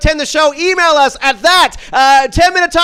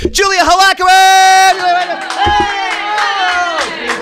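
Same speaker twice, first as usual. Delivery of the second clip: big host announcement; 6 semitones higher, slower than usual. Real, not acted. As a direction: call her name out to the room. Host announcing guest to stage.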